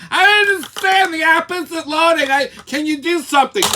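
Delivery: mocking high, whiny girl voice; mocking clueless girl. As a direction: pitch it way up and whine like a helpless customer. Mimics the chinless girl a third time.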